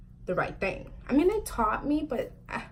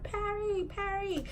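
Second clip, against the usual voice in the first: high, softer repeated name call; mock-urgent double call, pitched up; imitated call; 11 semitones higher, 8 dB quieter, slower than usual. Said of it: "She's like".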